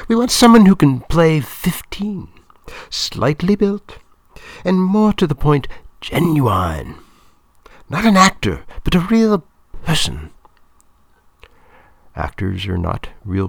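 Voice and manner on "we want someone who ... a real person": high, loud, emphatic woman's voice; Claudia, emphatic. A narrator's reading. Film woman describing the teenager she wants, high and emphatic. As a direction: spell out the wish list high and forcefully.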